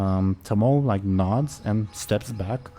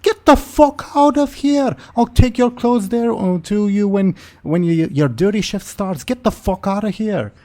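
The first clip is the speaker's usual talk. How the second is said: very high, loud shouted eviction; furious mother character yelling high; irate woman; 12 semitones higher, 7 dB louder than usual. Acted. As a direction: bellow him out in a shrieking woman's voice. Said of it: "And she says".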